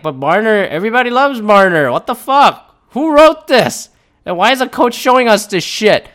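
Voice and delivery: building indignant teammate outrage; outraged disbelief. Retold or imitated. Teammates seeing the list, rising shocked outrage.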